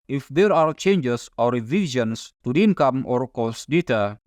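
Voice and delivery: normal talking voice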